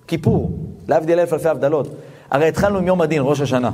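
normal talking voice

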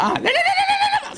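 put-on very high, altered little old lady voice; old grandma voice. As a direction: squeak "לא, לא" like a sweet old grandmother. Grandma refusing the button. (סבתא מסרבת בקול דקיק וגבוה מאוד)

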